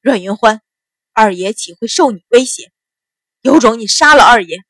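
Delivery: loud defiant challenge, building; cold, gritted-teeth sneer. A narrator's reading. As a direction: dare them through gritted teeth. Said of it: "秦鹏咬牙冷笑道".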